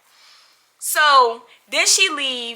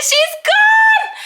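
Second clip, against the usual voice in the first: much higher, louder victory shout; gleeful shout; 6 dB louder than usual. Real, not acted. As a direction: shout it out in triumph. Gleeful call to friend.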